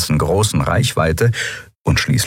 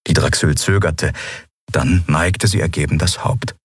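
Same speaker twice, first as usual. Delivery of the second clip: usual speaking voice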